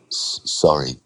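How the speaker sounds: low, stammered apology; hesitant. Acted. Cowed borrower backing down to the lender, hesitant. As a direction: catch on the first sound, then apologise meekly. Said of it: "Andre said hesitantly".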